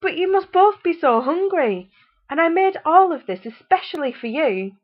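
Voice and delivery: high, loud sugary pleading; disappointed mother. A narrator's reading. Disappointed mother urging full children to keep eating. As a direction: coax them with fake sweetness. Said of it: "very disappointed with them".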